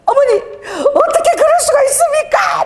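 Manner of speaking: high, wailing reenacted complaint; tearful protest. Preacher replays a tearful phone call to mother, wailing protest. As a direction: cry out in drawn-out mock anguish. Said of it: "막 이러고 막 울면서".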